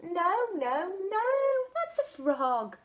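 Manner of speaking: eager correcting; excited correction. Acted. Little monkey telling the butterfly no, that's a snake, high and excited.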